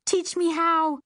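high, loud squealed demand; excited shriek. A narrator's reading. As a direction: shriek it with eager impatience. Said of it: "I shrieked".

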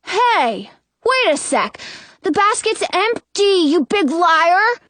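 put-on high, indignant kid's voice; accusing little boy. A narrator's reading. Brother discovering the empty basket, accusing. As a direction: call out the lie, stretching the insult.

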